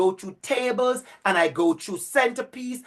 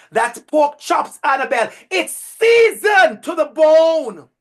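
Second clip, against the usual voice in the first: excited shout; 7 semitones higher, 7 dB louder than usual. Real, not acted. Bragging about the pork chops.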